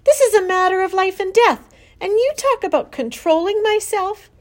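high, loud, outraged protest; yelling. A narrator's reading. Daughter argues for the pig's life, yelling. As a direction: yell it passionately at full pitch. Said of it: "yelled Fern".

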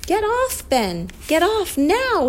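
high-pitched yelled order; high cry of urgency without full volume; urgent yell. A narrator's reading. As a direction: call out a warning, stretching the last words. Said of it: "he yelled".

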